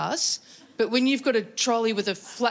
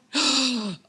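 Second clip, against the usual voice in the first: sudden gasp; 3 semitones higher than usual. Retold or imitated. Remembers iPad in car.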